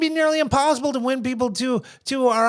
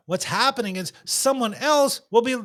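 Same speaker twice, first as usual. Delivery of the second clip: ordinary talk, nothing marked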